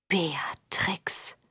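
stunned, voiceless whisper. A narrator's reading. Zinder learns who the girl is, whispering. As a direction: breathe the name out in a whisper. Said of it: "flüsterte Zinder".

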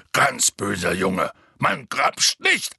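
slow, loud telling-off; stern scolding. A narrator's reading. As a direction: lecture a naughty child, word by word. Hagrid scolds the giant. (langsames, strenges Ausschimpfen)

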